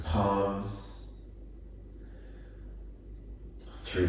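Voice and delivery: calm, unhurried body-scan voice with long pauses; guided meditation. Real, not acted. Yoga teacher guiding listeners through a body scan, calm guided meditation. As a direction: ease them into stillness, phrase by phrase.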